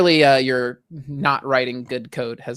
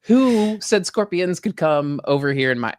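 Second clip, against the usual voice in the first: mock outrage; 5 semitones higher, 6 dB louder, slower than usual. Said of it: "I was just like".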